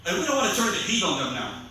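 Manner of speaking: ordinary talk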